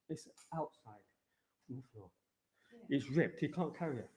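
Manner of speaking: distant voice away from microphone; talking from across the room; far off mic. Real, not acted.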